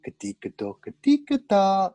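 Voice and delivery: slow, strange invented warlord tongue, rising on the last word; drawn-out gibberish, stretched and sung up at the end; made-up language. Retold or imitated. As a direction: Attila calling to Larry in invented speech. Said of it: "and I went".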